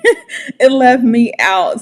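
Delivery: ordinary talk, nothing marked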